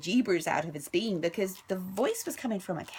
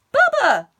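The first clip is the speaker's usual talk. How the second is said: loud, high yelled name; shout; 13 dB louder than usual. Acted. Stepbrother yelling for Bubba, loud and high. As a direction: bellow his name across the ranch. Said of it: "shouted Milton".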